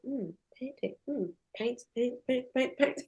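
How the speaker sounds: very soft, musing repetition; hushed listing. Retold or imitated. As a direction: drop right down and tick them off softly. Acts out paint taking over room.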